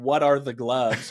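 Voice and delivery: ordinary talk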